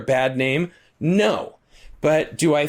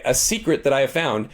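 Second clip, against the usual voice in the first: ordinary delivery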